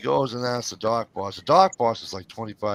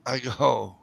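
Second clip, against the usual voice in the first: ordinary talk, nothing marked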